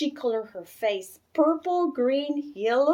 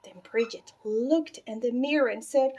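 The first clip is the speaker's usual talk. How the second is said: plain reading voice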